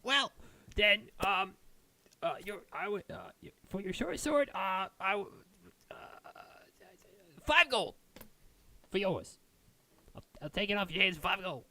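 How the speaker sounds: high dwarf voice, fumbling then rushing the offer; haggling dwarven smith. Acted. Dwarf smith offering five gold for the short sword, high and hurried. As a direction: make a hasty lowball offer.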